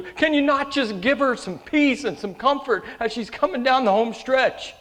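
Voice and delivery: loud, high retold cry to God; impassioned plea.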